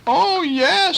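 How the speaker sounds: very high, eager piglet voice. A narrator's reading. Truffles agreeing to cakes, high and eager. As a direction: squeal yes like an excited little pig. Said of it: "said Truffles".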